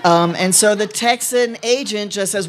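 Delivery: usual speaking voice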